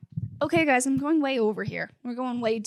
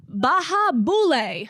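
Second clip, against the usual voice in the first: high, swooping celebratory catchphrase; happy expression; 4 semitones higher, 4 dB louder, slower than usual. Retold or imitated. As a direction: sing it out like a happy toast. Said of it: "a very happy expression".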